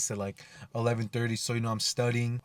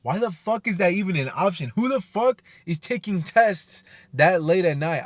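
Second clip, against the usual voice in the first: retold angry rant; 7 semitones higher, 6 dB louder than usual. Venting to viewers about a late-night exam slot, angry and high. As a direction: replay the outrage, voice cracking up high. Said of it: "I was so mad".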